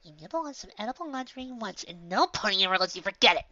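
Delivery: high-pitched sulky complaint; pouting. A narrator's reading. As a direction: pout through a high, girlish grumble. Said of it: "Pinkie pouted".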